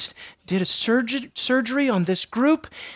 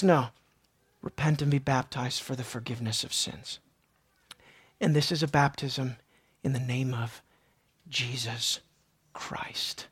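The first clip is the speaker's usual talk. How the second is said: lower, much softer gentle answer; hushed Peter; 11 semitones lower, 11 dB quieter than usual. Acted. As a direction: say it softly, almost fading out. Peter's answer, soft and low.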